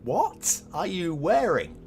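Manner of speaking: slow, loud, high mock disbelief; incredulous question. Imagined onlooker at the odd T-shirt, incredulous.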